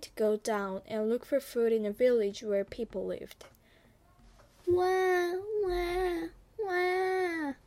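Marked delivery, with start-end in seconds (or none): 4.7-7.5 high, drawn-out imitation of a baby's wail; mock wailing like a baby; baby crying. Storyteller imitates a baby crying nearby, high mock wailing. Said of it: "A baby was crying".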